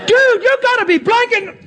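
very high mock incredulity; hammed-up flabbergasted squeal; imitated astonishment. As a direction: play a guy blown away, half-cursing. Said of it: "I ain't never seen this".

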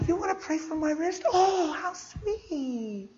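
patronizing. Retold or imitated. Lady humouring a little girl who offers prayer, patronizing. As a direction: humour the child like she's cute. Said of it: "she's patronizing".

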